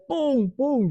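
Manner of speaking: two high, punchy knocking noises; imitated door pounding. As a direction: act out fists banging on a door. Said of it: "Pego a dar puños en la puerta". (golpes en la puerta imitados, agudos)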